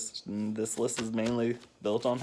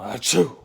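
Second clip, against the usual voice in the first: imitated sneeze; 2 semitones higher, 7 dB louder than usual. Son sneezes in dad's face.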